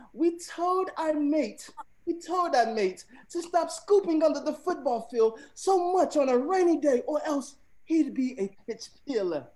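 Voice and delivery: high-pitched, hammed-up British accent; attempted British accent. Shown as a demonstration. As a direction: read it high in a strained try at British. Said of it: "in a British accent".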